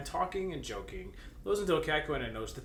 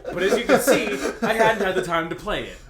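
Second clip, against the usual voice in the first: laughing; 4 semitones higher, 9 dB louder than usual. Acted. Reading dialogue through laughter.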